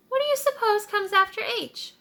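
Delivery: a wondering little animal; high curious sheep voice; sheep's voice. Acted. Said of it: "she asked".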